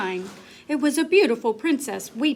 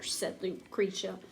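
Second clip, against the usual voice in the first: usual speaking voice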